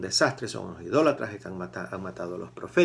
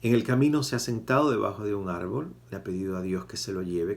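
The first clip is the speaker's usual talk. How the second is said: normal talking voice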